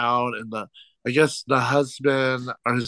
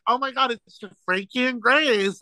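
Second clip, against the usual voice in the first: excited exclaim; 9 semitones higher than usual. Retold or imitated. Retold teasing of old ladies.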